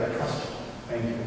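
very soft, humble thanks; hushed acted trust. Child reassured by dad on the road, hushed trust. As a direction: yield quietly, as a child trusting a parent.

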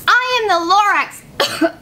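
a lightly voiced ailing Lorax; mild croak from the smog; coughing Lorax. A narrator's reading. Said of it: "He coughed and he whipped".

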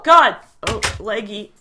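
ordinary talk

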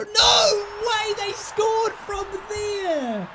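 laughing disbelief, then a high slow outraged yell; can't believe that went in; incredulous high shout; disbelieving shout. Real, not acted. Opponent scores from distance.

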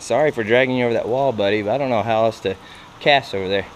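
normal talking voice

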